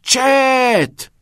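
high, explosive one-word shout; loud yell. A narrator's reading. Knox just before his foot hits the ball, yelling. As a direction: yell it out with the force of a kick. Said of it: "با صدای بلند فریاد زد".

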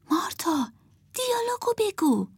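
high, hushed stage prompt; under the breath. A narrator's reading. Emma prompting Martha mid-show to say the line, under the breath. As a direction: whisper the cue urgently. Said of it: "زیر لب گفت".

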